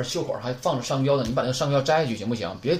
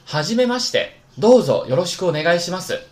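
loud, higher teacher model reading; model Japanese phrase; 5 semitones higher, 7 dB louder than usual. Shown as a demonstration. Teacher modelling a greeting for the class, loud and clear. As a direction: read it out crisply for students to copy. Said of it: "表示初次见面，请多关照".

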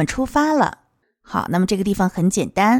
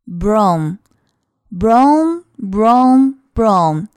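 very slow, louder "Brom" engine rumble; bus engine noise; 4 dB louder, much slower than usual. Shown as a demonstration. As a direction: rumble like a bus pulling away. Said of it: "公交车开起来它启动的声音". (模仿公交车启动的声音)

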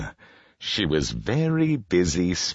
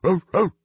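high, short imitated barks; dog's bark; 5 dB louder than usual. A narrator's reading. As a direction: bark like a dog, twice. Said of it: "barked the dog".

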